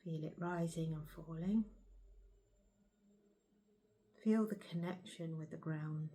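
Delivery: soft, unhurried meditation guide; guided meditation. Acted. Guiding viewers to settle into their bodies, soft and calm. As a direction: ease the listener gently into stillness.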